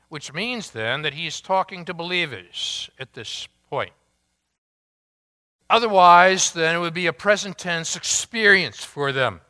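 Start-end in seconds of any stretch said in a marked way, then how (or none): none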